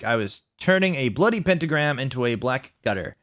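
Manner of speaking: ordinary delivery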